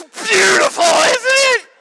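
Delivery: high, very loud ecstatic shriek; manic rapture. Retold or imitated. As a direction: imitate hysterical, overwhelmed delight at full volume. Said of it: "She's laughing like a mad".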